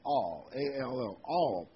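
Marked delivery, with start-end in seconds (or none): 1.2-1.7 all said louder and drawn out, set apart; all, held and weighted like a key word; stress on all. Shown as a demonstration. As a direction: lean hard on all. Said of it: "I want to emphasize the word A L L".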